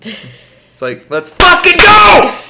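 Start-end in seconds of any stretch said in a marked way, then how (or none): 1.1-2.4 high, loud, slow sarcastic cheer; sarcastic shouted cheer; mock hype shout. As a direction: yell it like a fake pep rally. Mocking lazy kids' fake enthusiasm.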